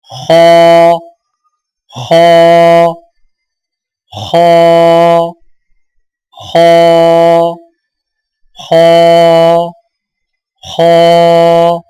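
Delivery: खौ said with lips kept flat, not rounded; unrounded kh sound. Shown as a demonstration. Tajweed teacher drilling the heavy letter for students, lips unrounded. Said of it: "होंठ गोल ना करें।".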